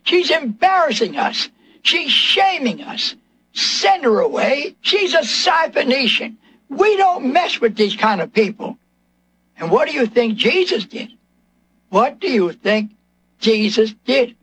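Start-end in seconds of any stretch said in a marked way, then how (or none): 0.0-8.8 higher, drawn-out complaint of the apostles; acted indignant outcry. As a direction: cry out in raised-pitch, slow protest. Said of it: "They cried out to Jesus".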